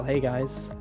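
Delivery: normal talking voice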